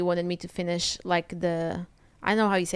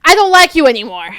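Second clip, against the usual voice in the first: imitating mom's loud, raised-pitch outburst; exasperated yell; 7 semitones higher, 13 dB louder than usual. Mom snapping at granddaughter who won't eat, yelling. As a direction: burst out at full volume, fed up.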